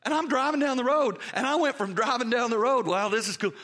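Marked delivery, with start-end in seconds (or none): 2.9-3.7 laughing wonder. Retold or imitated. Retelling crying in the car.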